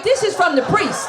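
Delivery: "This" hit high with a laugh; laughing punchline. Acted. Comedian tells the audience the drugs came from the priest, laughing and high. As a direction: laugh through the reveal.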